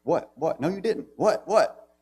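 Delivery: mock angry teen. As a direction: imitate a stubborn kid demanding what over and over. Imitating Evan's angry insistence.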